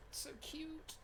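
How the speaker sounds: high unvoiced gushing whisper of "It's so cute". Real, not acted. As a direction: breathe it softly in awe. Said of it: "look at the heart".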